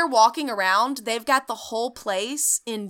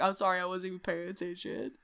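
sheepish Nick; 3 semitones lower, 9 dB quieter, faster than usual. Retold or imitated. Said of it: "And Nick's like".

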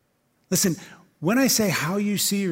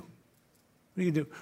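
much softer repeat of the question; soft echo; 7 dB quieter than usual. Acted. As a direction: let the question fade, softer.

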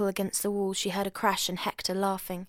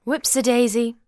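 put-on drunken cry; 4 semitones higher, 9 dB louder than usual. A narrator's reading. Drunk man clattering bicycle.

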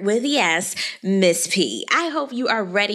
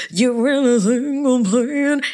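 sassy challenge; 3 semitones higher, 3 dB louder, slower than usual. Pretend. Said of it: "I wanna do that".

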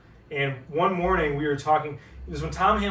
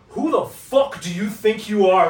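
slow, loud, higher in-your-face snarl; angry confrontation; 7 semitones higher, 8 dB louder, slower than usual. Retold or imitated. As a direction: confront him like an angry PR man. PR guy confronting him angrily.

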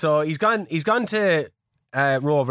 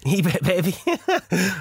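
laughing; 4 semitones higher, much slower than usual. Real, not acted. Host laughing at his own eBay baby joke, drawn out slowly.

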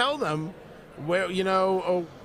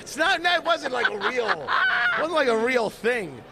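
laughing; 5 semitones higher than usual. Real, not acted. Embarrassed after being teased.

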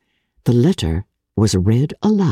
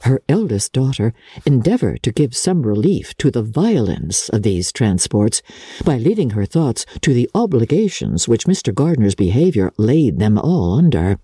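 ordinary delivery, nothing marked

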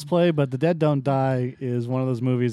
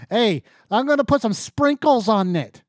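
higher-pitched, hammy fake Massachusetts accent; bad Massachusetts accent; 5 semitones higher than usual. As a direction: mimic a bad actor doing Massachusetts. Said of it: "bad actors that don't know how to do a fucking accent from Massachusetts".

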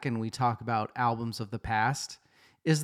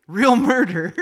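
high, loud laughing repeat; 10 semitones higher, 6 dB louder than usual. Acted. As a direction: laugh the wrong word out high. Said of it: "Real murder, true crime, same thing".

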